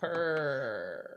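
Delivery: soft, low-key catchphrase purr; drawn-out purr. Pretend. Co-host answering the South Carolina shout, drawn-out purr. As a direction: stretch it out softly.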